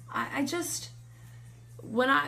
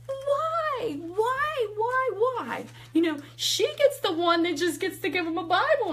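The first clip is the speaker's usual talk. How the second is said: high-pitched wailing complaint to God; hammed-up tearful whine of injustice; mock crying; 11 semitones higher than usual. Crying to God driving home, mock wail. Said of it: "I cried the whole way home".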